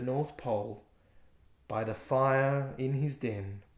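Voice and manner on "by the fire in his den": plain reading voice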